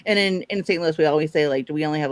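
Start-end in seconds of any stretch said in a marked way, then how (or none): none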